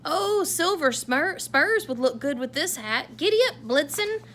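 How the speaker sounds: thrilled cowboy, giddy and loud; excited exclaim. Acted. Santa thrilled with his cowboy hat, loud and high. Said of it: "he exclaimed".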